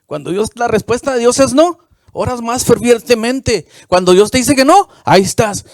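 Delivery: loud, high, fervent preaching; fiery pulpit preaching; preaching at full force. Acted.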